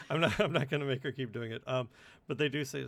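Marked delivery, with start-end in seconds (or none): 0.0-1.6 laughing. Real, not acted. Laughing at the creepy voice.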